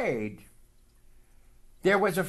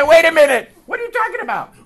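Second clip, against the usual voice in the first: much higher, louder indignant brother; alarmed protest; 14 semitones higher, 15 dB louder, much faster than usual. Retold or imitated. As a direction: squawk it in disbelief.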